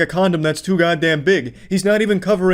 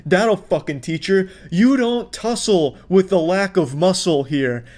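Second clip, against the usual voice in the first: ordinary talk, nothing marked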